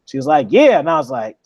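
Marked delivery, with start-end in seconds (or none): 0.5-0.8 high, loud, eager yes; a mock eager yeah. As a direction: imitate her jumping at it. Her eager yes to cooking.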